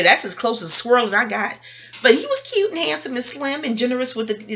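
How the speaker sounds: ordinary talk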